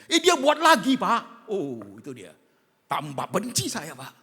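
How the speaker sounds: loud, high indignant cry; exasperated outburst. Acted. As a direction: burst out that he did it again. Congregant outraged he did it again.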